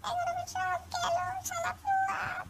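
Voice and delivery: high, soft whining moan; comic lament.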